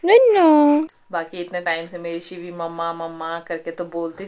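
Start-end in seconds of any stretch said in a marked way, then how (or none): 0.0-0.9 loud, high soothing coos. Real, not acted. Soothing crying baby.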